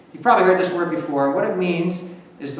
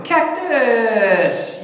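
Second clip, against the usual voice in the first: high, loud, drawn-out shout; imitated viewers' yell; 8 semitones higher, 6 dB louder than usual. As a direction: yell it like the kids at home. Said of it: "everybody at home is yelling".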